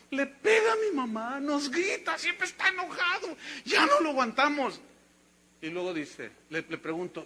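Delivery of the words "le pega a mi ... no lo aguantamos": put-on higher, pleading girl's voice; voicing the crying girl. Girl telling her pastor about her father, higher and pleading. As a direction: plead like a tearful young girl.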